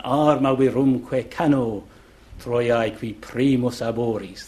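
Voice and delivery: "Arma virumque cano" recited with strong dactylic beat; dactylic Latin line. Shown as a demonstration. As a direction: scan the Latin verse, lean on each long syllable. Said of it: "There's your dactylic line".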